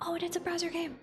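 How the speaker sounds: high, fast delighted gasp; excited. Real, not acted. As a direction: squeal with delight. Excited discovery of browser game.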